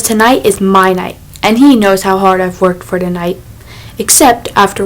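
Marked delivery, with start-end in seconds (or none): none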